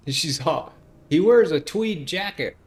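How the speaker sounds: high, loud fangirl gushing; excited gushing. Real, not acted. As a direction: gush about him excitedly. Gushing about the character.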